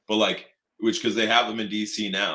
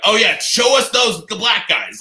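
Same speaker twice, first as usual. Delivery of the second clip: much higher, louder and eager; eager mock cop; 12 semitones higher, 10 dB louder than usual. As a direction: squeal it like an excited officer. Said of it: "they're like".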